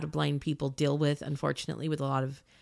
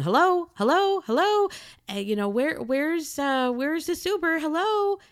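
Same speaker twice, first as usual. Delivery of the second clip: much higher, louder call into the lot; 11 semitones higher, 4 dB louder than usual. Retold or imitated. Blind rider searching the parking lot for her Uber, calling out loud. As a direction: call out hello to cars you can't see.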